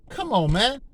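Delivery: ordinary talk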